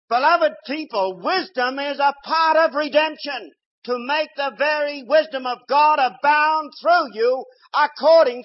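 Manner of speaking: loud, high, fervent preaching; full-force preaching. Acted. Preacher exhorting his congregation, loud and fervent. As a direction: rouse the whole congregation.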